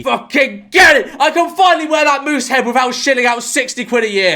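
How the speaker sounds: loud, high celebratory yell; triumphant shout. Acted. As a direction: burst out cheering at a freebie. Celebrates free memberships.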